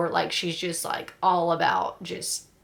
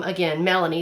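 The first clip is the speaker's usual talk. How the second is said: ordinary talk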